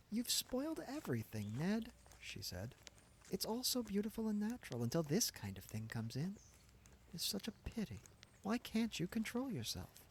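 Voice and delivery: a hint of a disappointed young woman; young woman Gladys. A narrator's reading. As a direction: narrator lifts into Gladys, gently scolding. Gladys voiced by narrator.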